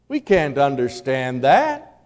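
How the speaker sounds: normal talking voice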